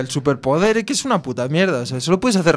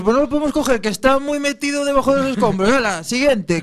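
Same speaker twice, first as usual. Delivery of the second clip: higher, breezy brush-off; mock dismissive hero; 6 semitones higher than usual. Mocking the X-ray hero at the rubble, lightly higher and offhand. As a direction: shrug off the victim like a lazy superhero.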